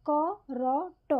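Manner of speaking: করট very slowly, kô-rô-ṭô, one letter after another; syllable by syllable. Shown as a demonstration. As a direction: stretch each open vowel in করট: kô, rô, ṭô. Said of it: "একই রকমভাবে".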